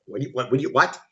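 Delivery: low, stammering confusion; baffled boyfriend. Retold or imitated. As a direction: stumble over the words as the puzzled boyfriend. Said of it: "along with the, the boyfriend in the movie".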